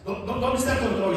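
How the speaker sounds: loud, high stammering demand for the remote; father yelling for the lost remote, loud and frantic. Retold or imitated. Angry dad stammering for the remote. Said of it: "Y empiezan a pelearse".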